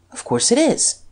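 high, brisk retort; impatient. A narrator's reading. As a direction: answer as if it's obvious, impatiently. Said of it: "said the Duke impatiently".

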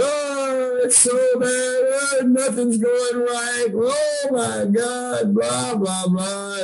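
high, whiny mock wail; imitating a blubbering lament. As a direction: ham up your own self-pity, whining high. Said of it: "And I'm blubbering".

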